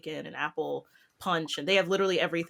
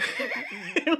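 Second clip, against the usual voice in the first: laugh; 6 semitones higher than usual. Real, not acted. Donut knocked away.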